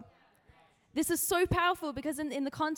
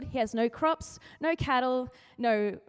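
normal talking voice

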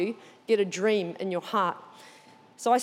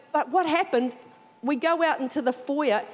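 normal talking voice

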